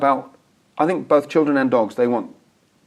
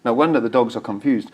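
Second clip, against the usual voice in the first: ordinary talk, nothing marked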